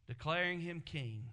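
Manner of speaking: ordinary delivery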